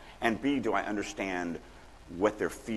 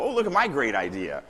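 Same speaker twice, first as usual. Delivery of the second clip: higher, louder gushing over one's own idea; mock self-congratulation; 5 semitones higher, 5 dB louder than usual. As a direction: parody a writer thrilled with themselves. Mocks an over-eager writer's self-praise.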